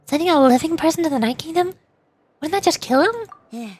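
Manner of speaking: acted protest with swooping pitch; alarmed objection. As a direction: question it like a worried game character. Voices objection in game dialogue.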